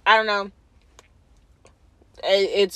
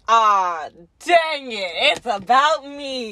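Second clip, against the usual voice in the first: dismayed groan; much slower than usual. Retold or imitated. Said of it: "He goes".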